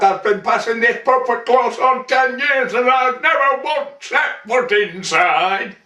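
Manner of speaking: high-pitched scolding in character; narrator's affronted landlady voice; indignant wife. A narrator's reading. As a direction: rattle off the grievance high and indignant. Wife mimics Joe's neglect, indignant.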